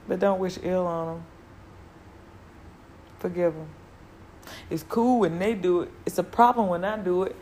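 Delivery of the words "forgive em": ordinary talk, nothing marked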